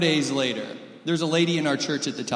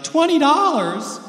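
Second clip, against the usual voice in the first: high, loud, slow mock whine of a letdown child; mock disappointed kid; 11 semitones higher, 7 dB louder, slower than usual. Kids opening a gift in front of people, mock disappointed whine. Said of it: "they're like".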